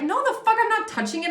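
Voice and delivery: high, panicky no way; imitating freaked-out refusal. Collective refusing the cop's offered object, high and panicky. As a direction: recoil from it in alarm, pitch shooting up. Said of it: "And you're like".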